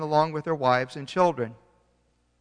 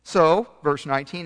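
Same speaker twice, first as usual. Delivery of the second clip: ordinary talk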